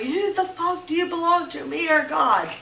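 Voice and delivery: replaying the abuser's furious demand; retold angry yelling. Abuser yelling at her during the beating, angry. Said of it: "he was like".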